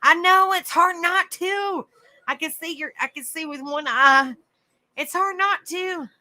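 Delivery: high, drawn-out whine; strained complaint. Real, not acted. Speaker fighting the urge to rub a burning eye, whining. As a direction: stretch the last words up high.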